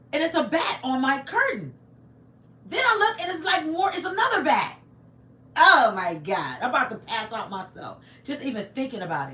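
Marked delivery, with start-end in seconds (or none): 2.7-4.8 shocked. Retold or imitated. Spotting a second bat. 5.6-6.6 dismayed. Real, not acted. Reacting to the bat memory.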